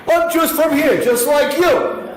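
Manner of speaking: Peter as a New Yorker. Retold or imitated. [name] voicing Peter denying his accent, a high New York protest. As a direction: insist in a put-on New York voice. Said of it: "imagine Peter ... as a New Yorker".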